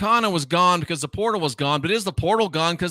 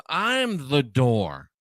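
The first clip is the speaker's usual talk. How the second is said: very slow, drawn-out quote; slow, deliberate scripture quote; solemn declaration; much slower than usual. Retold or imitated. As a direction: stretch each word as a weighty pronouncement. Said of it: "now Jesus says".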